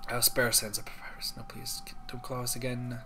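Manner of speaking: soft unvoiced whisper. Real, not acted. Streamer whispering game text to himself. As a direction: breathe the words out.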